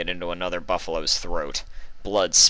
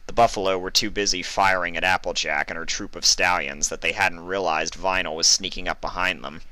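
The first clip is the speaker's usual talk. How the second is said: plain reading voice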